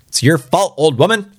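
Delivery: high-pitched put-on accusation; mock blaming old man. Old man blaming his wife in an added line, mock accusation. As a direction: blame her in a high character voice.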